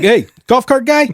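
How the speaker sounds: high friendly call across the lot. Retold or imitated. Nude resort guest hailing the repairman, high and friendly. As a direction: hail him cheerfully from his cart. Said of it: "And he's like".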